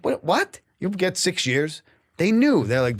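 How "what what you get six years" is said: high, stunned, slow disbelief; can't believe the sentence; shocked disbelief. Retold or imitated. Hearing inmates call his sentence, shocked disbelief. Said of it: "I'm like".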